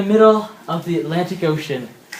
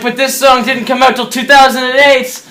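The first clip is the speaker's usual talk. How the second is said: mock disbelief; 5 semitones higher, 6 dB louder than usual. He protests the anachronistic song.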